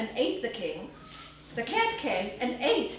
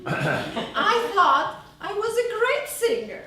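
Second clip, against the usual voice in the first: laughing, higher and louder; proud giggling boast; laughing while recalling how proud she was; laughing proudly; 7 semitones higher, 8 dB louder than usual. Real, not acted.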